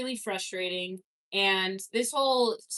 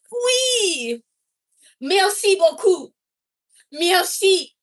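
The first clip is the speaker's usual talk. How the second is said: put-on much higher, louder Oui and Merci beaucoup in a caricature French accent; hammed-up French accent; 8 semitones higher, 8 dB louder, much slower than usual. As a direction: belt out Merci like a cartoon Frenchman. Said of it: "I gotta find the accent".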